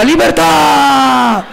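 slow, drawn-out mock battle cry; hammed-up cry of liberty; fart crying freedom. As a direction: stretch it out like a liberated prisoner. Fart personified shouting freedom.

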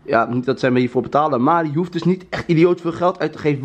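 usual speaking voice

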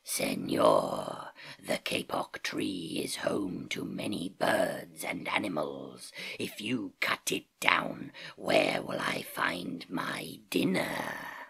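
a hungry big cat rumbling in the ear; growling jaguar. Acted. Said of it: "He growled in his ear".

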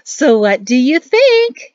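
high, loud, slow, ringing call; ringing mother-in-law voice. Acted. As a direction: call out proudly from behind him. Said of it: "his mother-in-law's voice ringing".

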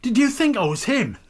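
jealous outburst. Acted. Husband accusing his wife of waiting for another man, furious. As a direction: throw it at her like a charge.

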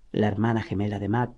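plain reading voice

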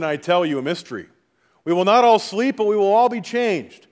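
ordinary delivery

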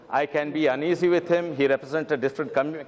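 ordinary delivery

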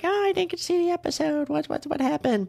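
high-pitched mock fan voice; whiny fan. Host mocks fans who missed the episode, high whiny impersonation. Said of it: "Bachelor fans who are like".

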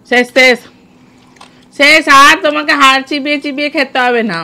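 loud relieved cry; relieved shout. Real, not acted. Relief after finishing challenge.

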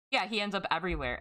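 ordinary delivery

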